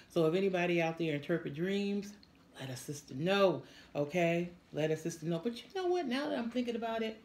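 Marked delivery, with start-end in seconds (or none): none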